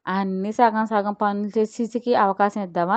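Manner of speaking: ordinary delivery, nothing marked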